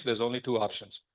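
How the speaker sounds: ordinary talk, nothing marked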